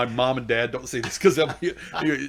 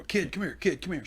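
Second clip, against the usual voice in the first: mild mock yell, higher pitch; retold yell; 7 semitones higher than usual. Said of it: "So I yell at her".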